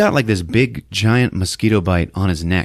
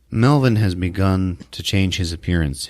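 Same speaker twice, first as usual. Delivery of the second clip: ordinary talk, nothing marked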